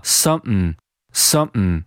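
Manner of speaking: "something" reduced to "sum'n" with a glottal stop; clipped-middle "sum'n" for "something"; weak form. Shown as a demonstration. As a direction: catch the throat mid "something", ending in n. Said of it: "给弱读成了".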